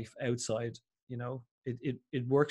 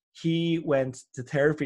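usual speaking voice

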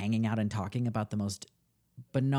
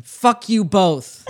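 loud, slow, higher yell; 7 semitones higher, 10 dB louder, much slower than usual. Retold or imitated. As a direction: bellow it after him. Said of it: "I yell after him".

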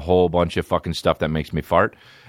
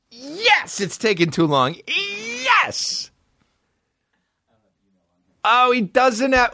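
much higher falsetto squeal of joy; mock excited squeal; much slower than usual. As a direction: shriek it like a giddy fan. Mock-excited waiting for link.

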